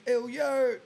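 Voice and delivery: high, loud mock yell of a friend; imitated scream. Rapper imitating his boy screaming in the background, mock scream. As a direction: holler it out like one of the boys. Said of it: "one of my boys screaming".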